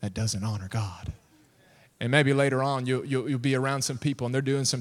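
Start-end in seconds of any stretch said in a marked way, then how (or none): 0.0-1.1 low, soft, voiced nudge; imitated whisper. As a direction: say it low and gently, almost whispering. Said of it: "he'll whisper to you".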